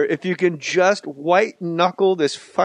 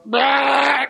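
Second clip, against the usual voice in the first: mock shout; 11 semitones higher than usual. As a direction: bark it out like a clown. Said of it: "He'd go".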